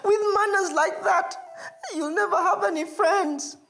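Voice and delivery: high, loud tearful little reproach; tearful scolding. Acted. Baby elephant scolding Mongolo, high and tearful. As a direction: scold him through tears.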